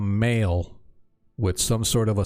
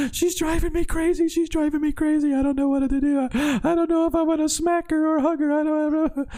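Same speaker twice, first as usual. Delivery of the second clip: very high, frazzled pleading; overwhelmed Alyssa. Acted. Alyssa complaining to the hero about the princess, frazzled and high. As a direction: voice her at the end of her rope.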